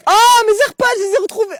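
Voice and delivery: very high, loud joyful shout. Acted. Retrouvant ses AirPods, cri de joie très aigu. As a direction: cry out in triumph.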